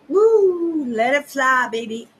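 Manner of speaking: loud, high excited whoop; excited cheer. Real, not acted. Cheering herself on.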